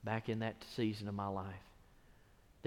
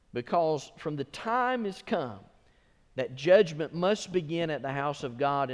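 slowed, a bit louder; preached scripture; 3 semitones higher, 6 dB louder than usual. Real, not acted. Pastor proclaiming judgment verse, slowed. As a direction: declaim the verse.

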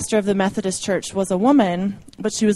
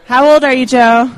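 loud, higher called-out question; calling out; 4 semitones higher, 11 dB louder than usual. Real, not acted. Speaker calls the birthday guest up before the crowd, calling out. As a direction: call it out across the room.

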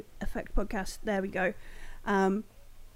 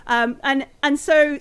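ordinary talk, nothing marked

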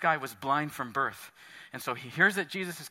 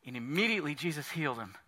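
usual speaking voice

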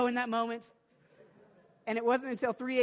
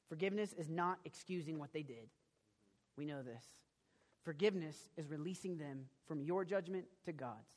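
gentle teaching; 7 semitones lower, 10 dB quieter than usual. Real, not acted. Pastor explaining forgiveness.

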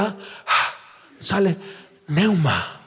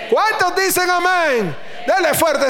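preacher's shout; 9 semitones higher, 3 dB louder than usual. Real, not acted. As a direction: shout ¿Cuántos dicen amén? stretching Cuántos. (grito de predicador alargando cuántos)

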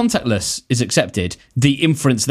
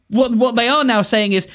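ordinary talk, nothing marked

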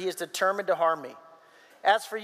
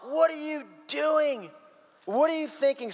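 mock-furious disbelief, pitch raised; acted-out outrage; 6 semitones higher than usual. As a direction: demand it like a father going ballistic, without shouting. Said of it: "And Saul goes ballistic".

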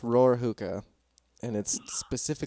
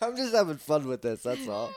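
crying with laughter; laughing so hard it sounds like crying; laughing to tears; 13 semitones higher than usual. Real, not acted. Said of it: "We're making people cry".

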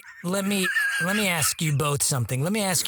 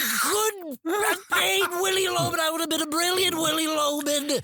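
much higher, proud declaration; indignant actor boast; 9 semitones higher than usual. Acted. Vain actor declares he'd be a brilliant Willy. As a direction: insist on your own greatness.

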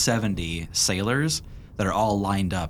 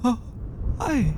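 put-on old medic voice.

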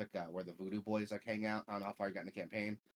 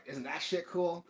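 higher, slower, showing off; proud vendor; 9 semitones higher, 3 dB louder than usual. Retold or imitated. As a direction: drag it out like a proud show-off. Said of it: "he's, like, so happy".

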